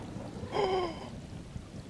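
breathy high gasp of amazement; sharp gasp. Real, not acted. Seeing his own cat on screen, a sharp amazed gasp. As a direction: suck in air in delighted shock.

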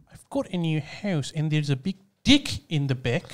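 put-on New Zealand accent, soft and low. Shown as a demonstration. Showing off for friends on request, best New Zealand accent. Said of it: "Give us your best New Zealand accent".

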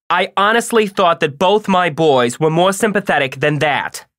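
loud, high motherly reproach; angry. A narrator's reading. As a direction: scold your sons with force. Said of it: "said Mom angrily".

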